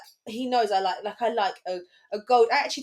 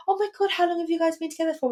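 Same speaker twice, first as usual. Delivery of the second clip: high, fast, gushing question; a waitress excited about their anniversary; 10 semitones higher, 3 dB louder, faster than usual. Retold or imitated. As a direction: gush it quickly. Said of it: "she went".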